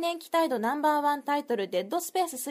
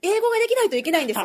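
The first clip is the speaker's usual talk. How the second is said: emphatic exclaim; 5 semitones higher, 8 dB louder, faster than usual. Real, not acted. Dramatic reveal to cohost.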